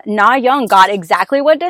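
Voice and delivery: ordinary delivery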